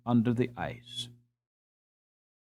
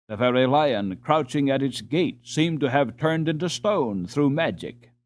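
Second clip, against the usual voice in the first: ordinary delivery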